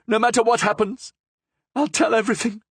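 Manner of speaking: high, eager cry; cried out. A narrator's reading. Prisoner crying out her agreement, high and eager. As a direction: give in with a cry.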